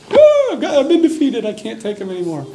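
very high wail, fading to a whimper; mock baby crying. The defeated devil in the corner, crying like a baby. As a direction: bawl it in hammed-up defeat, then tail off. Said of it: "like a little baby crying".

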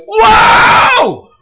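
loud, high whoa of wonder then a happy exclamation; amazed shout. Acted. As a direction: shout your amazement at the gift. Adam's delighted cry at bride.

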